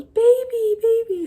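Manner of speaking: very high, loud toddler imitation; excited toddler. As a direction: squeal it out like a tiny child spotting something. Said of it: "every time he sees a baby in public, he goes".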